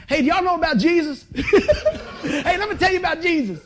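high, loud put-on voice, laughing through it; a kid hamming up his uncle preaching at the drive-through window; nephew imitating preacher. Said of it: "Acts like he's in the drive-through".

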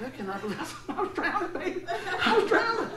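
high, laughing disbelief; high-pitched disbelieving cry; incredulous girlfriend. Retold or imitated. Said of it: "my girlfriend's going".

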